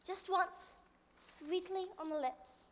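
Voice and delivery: gentle bedtime story reading; storytelling. Acted. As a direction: read it like a fairy tale to a child.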